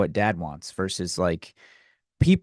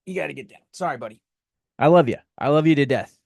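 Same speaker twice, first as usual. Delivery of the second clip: pet talk; 3 dB louder, faster than usual. Real, not acted. Coaxing the dog again.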